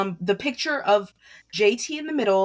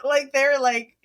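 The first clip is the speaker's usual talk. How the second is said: laughing; 4 semitones higher, 3 dB louder, slower than usual. Real, not acted. Laughing at the song.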